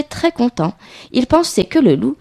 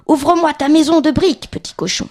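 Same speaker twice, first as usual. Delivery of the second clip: high demand, dropping on the address; demanding wolf; 7 semitones higher than usual. Acted. Wolf at the brick house ordering the pig to open, demanding. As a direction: call through the door as the story's wolf.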